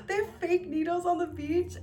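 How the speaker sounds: high, laughing disbelief; shocked to find fake needles on the beach; amazed question. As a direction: ask it in delighted shock. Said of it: "And I was like".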